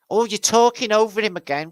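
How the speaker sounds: high, loud complaining voice; hammed-up irritated high scold; mock annoyed viewer. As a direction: scold someone for talking over him.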